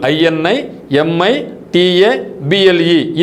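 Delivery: spelled in slow letter groups; slow spelling out. Shown as a demonstration. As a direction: spell INI ఎంఐ టీఏ BLE in slow chunks. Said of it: "ఇన్ plus imitate గుర్తుపెట్టుకుంటే".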